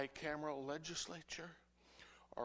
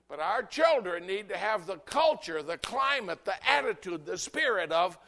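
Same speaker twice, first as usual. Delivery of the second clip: much louder preaching build; rising preaching; 3 semitones higher, 13 dB louder than usual. Real, not acted. Preacher rallying the congregation.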